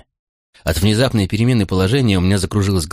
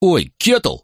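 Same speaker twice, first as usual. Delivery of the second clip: pained yelp; 9 semitones higher than usual. A narrator's reading. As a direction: yelp in protest at being pinched. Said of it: "взвыл я и попытался вырваться". (взвыть от боли)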